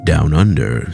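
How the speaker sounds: ordinary delivery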